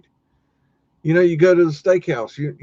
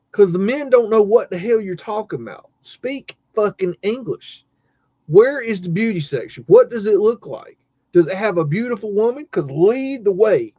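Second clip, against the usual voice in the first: higher-pitched mock tirade; exasperated rant; 6 semitones higher than usual. Baffled man ranting at the lady about the beauty section, exasperated. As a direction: demand answers with comic indignation.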